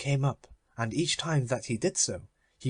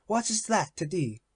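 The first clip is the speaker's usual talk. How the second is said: higher, proud miser voice; Avarice's voice; 7 semitones higher than usual. Acted. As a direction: raise the pitch, possessive and cold. Avarice answers Death.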